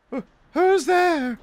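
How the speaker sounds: very high, slow quavery falsetto; nervous woman. Acted. Nervous neighbor answering a stranger at the door, high falsetto. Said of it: "whoever it was sounded nervous".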